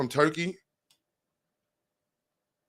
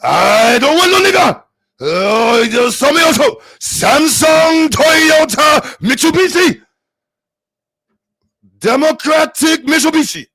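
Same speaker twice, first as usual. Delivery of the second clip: put-on high, loud, angry caricature Japanese accent; shouted hammed-up Japanese impression; mock Japanese accent; 12 semitones higher, 9 dB louder, slower than usual. Streamer trying Japanese for chat, shouted angry mock accent. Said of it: "I wanna get the Japanese one".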